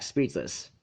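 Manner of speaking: ordinary delivery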